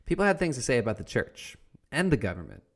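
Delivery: ordinary delivery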